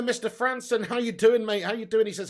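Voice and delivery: ordinary talk, nothing marked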